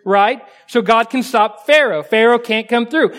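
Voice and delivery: ordinary talk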